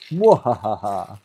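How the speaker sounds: slow evil villain laugh; sneering panto villain. Pretend. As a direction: cackle like a panto baddie. Showing secret parts, villain laugh.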